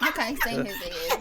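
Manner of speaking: ordinary delivery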